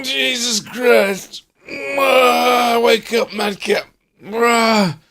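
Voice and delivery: high drawn-out groans; groaning. Real, not acted. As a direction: groan yourself awake. Groggy after lunch, groaning awake.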